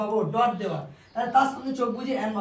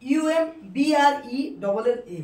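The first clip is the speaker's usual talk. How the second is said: ordinary talk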